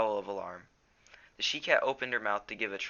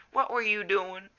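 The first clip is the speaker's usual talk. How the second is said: high, loud, shaken demand; angry fearful demand; 11 semitones higher, 7 dB louder than usual. A narrator's reading. Graypaw scolding Firepaw for nearly getting hit, angry. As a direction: demand an answer, still shaken. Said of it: "trembled with fear and anger".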